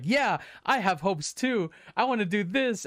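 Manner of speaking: high-pitched, eager imitation of friends rallying; retold excited outburst. Despairing friends catch the kid's hope, calling out excitedly. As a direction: chime in high, acting out newfound hope.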